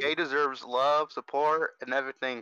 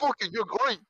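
aggressive compliment; 6 semitones higher than usual. Retold or imitated. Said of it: "I could just hear that in his voice too".

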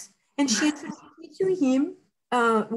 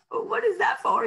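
higher, asked through laughter; laughing question; 8 semitones higher than usual. Retold or imitated. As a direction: giggle out the question. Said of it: "I was like".

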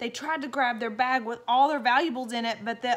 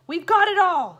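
much higher, louder triumphant shout; gleeful burglar; 10 semitones higher, 12 dB louder than usual. Acted. Burglar gloating to his partner, loud and high. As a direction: crow over the loot. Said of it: "said the second burglar".

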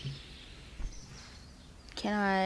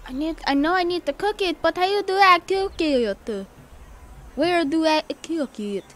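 mock whining; 5 semitones higher, 4 dB louder than usual. Whining repeatedly at the game.

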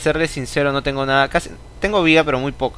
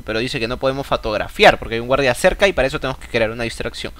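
normal talking voice

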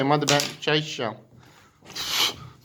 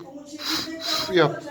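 high singsong coaxing; 12 semitones higher, 4 dB quieter than usual. Real, not acted. Coaxing child to blow tea.

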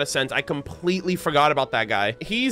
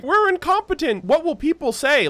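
high, whiny mock voice for the knights; 11 semitones higher than usual. Streamer mocking the game's knights, high whiny voice. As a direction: whine it like a panicky knight. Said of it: "people will be like".

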